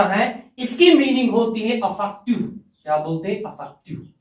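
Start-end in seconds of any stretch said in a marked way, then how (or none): 3.4-4.1 barely audible student answer, whispered; timid whisper. Pretend. Pupil answering the teacher too quietly, in a whisper. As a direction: mumble it as a shy pupil who dares not speak up. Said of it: "जरा जोर से बोलो, मैं सुनाई नहीं दे रहा।".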